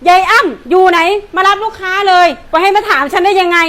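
loud, bossy scolding; angry yelling. Acted. Shop owner yelling at her helper to serve customers, loud scolding. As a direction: bark orders at the helper.

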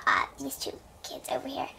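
quick soft whisper. Acted. Aside said quietly.

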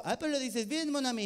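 mock French accent. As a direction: play the French speech therapist delighted. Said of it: "logopeda francés".

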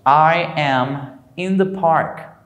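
I am in the park, slow and clearly spaced; slow model sentence. Shown as a demonstration. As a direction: model it slowly for students. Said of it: "yo estoy en el parque". (frase modelo lenta)

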